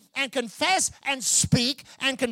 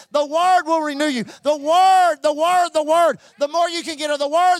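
impassioned loud preaching; 6 dB louder than usual. Real, not acted. Preacher urging the church on the Word, loud and impassioned. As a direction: hammer "the Word" with a forceful beat each time.

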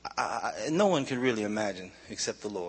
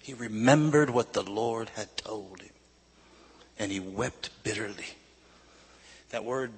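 usual speaking voice

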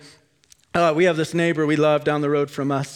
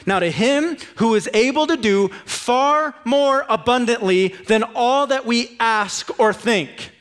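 slow, lifted reading of the verse; solemn scripture reading; 4 semitones higher than usual. Acted. Preacher reading Ephesians to the church, slow and solemn. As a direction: proclaim each phrase with weight.

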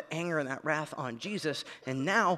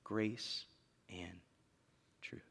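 soft, spaced-out words after the shout; hushed; 8 semitones lower, 11 dB quieter, much slower than usual. Real, not acted. Preacher quietly summing up after the climax, hushed. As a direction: let it land gently.

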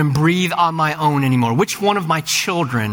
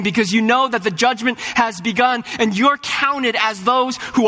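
impassioned preaching; 7 semitones higher than usual. Acted. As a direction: preach it out with rising fire. Preaching rises to full force.

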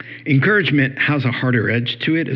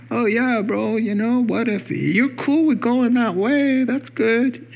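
high-pitched, noncommittal buddy voice; mock easygoing approval; 10 semitones higher than usual. Friend waving someone on toward a washed-out bridge, mock easygoing. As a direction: play the agreeable bro who won't object.

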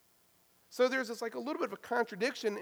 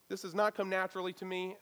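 usual speaking voice

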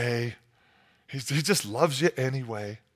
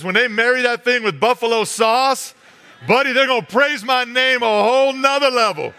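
louder, higher and gleeful; excited boast; 7 semitones higher, 6 dB louder than usual. Acted. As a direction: sell it like a proud, giddy creator. God boasting about buffalo chicken.

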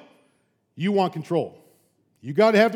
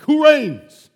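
higher, louder repeat of "who reigns"; God pressing the question home; rising insistence; 8 semitones higher, 9 dB louder than usual. Acted. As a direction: push harder the second time, hitting "who".

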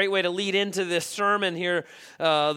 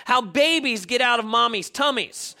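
put-on child voice; 6 semitones higher, 5 dB louder than usual. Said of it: "He goes".

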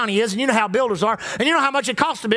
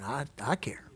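low, soft reassuring voice of God; a soft, low comforting God; tender reassurance; 10 semitones lower, 4 dB quieter, faster than usual. Retold or imitated. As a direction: gently promise to carry it all. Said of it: "He said".

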